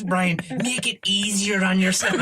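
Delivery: bad Armagh accent. The wedding woman telling Brian to spare himself the trouble, in a bad Armagh accent. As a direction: hand out motherly advice in a put-on Armagh accent. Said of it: "this is a bad Armagh accent".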